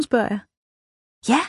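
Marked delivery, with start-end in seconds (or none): 1.3-1.4 short jubilant exclamation; cheering. A narrator's reading. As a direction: cheer it with delight. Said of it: "jubler Runa".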